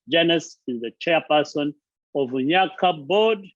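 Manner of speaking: normal talking voice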